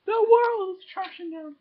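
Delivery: mock dramatic. Mock despair at paper.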